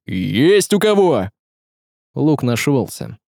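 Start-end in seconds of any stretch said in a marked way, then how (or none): 0.1-1.3 slow, drawn-out higher call; urgent exclamation. A narrator's reading. Горыня asks the men for a bow, urgent calling out. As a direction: call out to everyone around. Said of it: "воскликнул Горыня".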